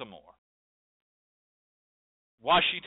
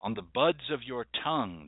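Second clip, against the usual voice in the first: ordinary talk, nothing marked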